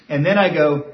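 ordinary talk, nothing marked

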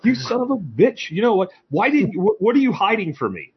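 high-pitched mock outrage; hammed-up accusing rant; imitating angry friend. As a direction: play the furious friend on the phone, way up high. Retold angry phone call from Brit.

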